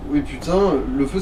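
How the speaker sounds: normal talking voice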